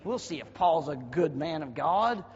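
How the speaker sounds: ordinary talk, nothing marked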